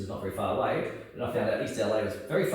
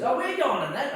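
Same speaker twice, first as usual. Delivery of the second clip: high mock cheerful instructor; lightly voiced upbeat instructor; 12 semitones higher, 4 dB louder than usual. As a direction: chirp it like a friendly coach. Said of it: "And he goes".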